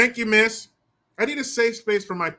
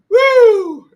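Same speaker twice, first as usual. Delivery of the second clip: high loud whoop of glee; 14 dB louder than usual. Real, not acted. Celebrating Liz Cheney's loss with a loud whoop. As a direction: let out a big celebratory whoop.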